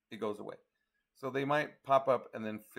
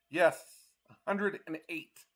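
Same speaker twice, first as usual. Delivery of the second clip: surprised; 6 semitones higher, 4 dB louder, slower than usual. Real, not acted. Stunned by the heat forecast.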